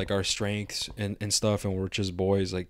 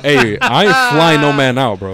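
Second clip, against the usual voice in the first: laughing; 5 semitones higher, 13 dB louder, slower than usual. Real, not acted. Laughing refusal of the guest.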